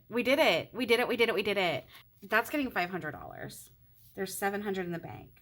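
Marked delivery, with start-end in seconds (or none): 0.1-1.9 louder, chanted celebration; we did it, real excited chant; excited cheer. Real, not acted. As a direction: cheer it again and again. Celebrates paying off the fund.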